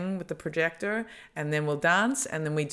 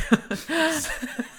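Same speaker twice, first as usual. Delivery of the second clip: high burst of laughter; 12 semitones higher than usual. Real, not acted.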